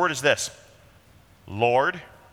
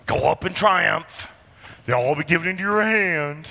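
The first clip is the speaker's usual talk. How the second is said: mocking sarcasm; 4 semitones higher than usual. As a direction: say it so the king hears he's being mocked. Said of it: "he's being mocked".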